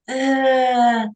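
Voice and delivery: high, drawn-out mock groan; reluctant groan. Student reacting to internships, reluctant mock groan. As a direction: groan a long, doubtful uh.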